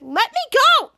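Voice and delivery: high loud protest; yell let me go. Acted. Charlotte yelling at Jasper to let her go. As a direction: shout to be let go.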